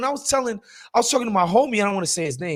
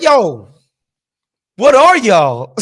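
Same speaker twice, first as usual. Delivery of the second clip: loud, amused retold "what are you?"; mock-baffled question; 2 semitones higher, 8 dB louder, much slower than usual. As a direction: call it out in comic bafflement, a laugh in it. Retells blunt question to Armenian date.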